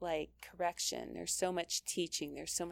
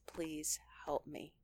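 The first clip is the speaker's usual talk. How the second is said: whispered, slow, desperate plea; hushed prayer for help; 2 semitones lower, 6 dB quieter, slower than usual. Shown as a demonstration. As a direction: beg God under your breath. Acted prayer when losing temper.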